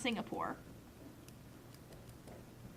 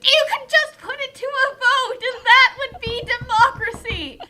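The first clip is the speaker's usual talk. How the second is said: laughing protest; 8 semitones higher, 9 dB louder than usual. Real, not acted.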